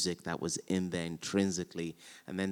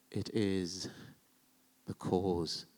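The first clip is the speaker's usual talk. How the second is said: softer, slow It is the cause; hushed verse reading; 6 dB quieter, slower than usual. Shown as a demonstration. Repeating Othello's line softly to show reluctance. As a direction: let cause fall heavily and quiet.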